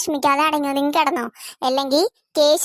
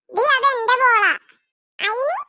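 put-on indignant child; 7 semitones higher than usual. Children quarrel over a ball.

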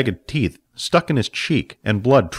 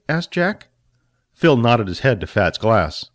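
usual speaking voice

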